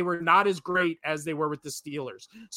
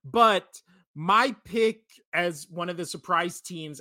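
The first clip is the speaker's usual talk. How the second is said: ordinary talk, nothing marked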